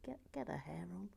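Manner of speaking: a soft unvoiced whisper to herself. Real, not acted. Whispering to the minifigure while fitting its hair. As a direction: breathe it under her breath. First take whispered, then repeated aloud.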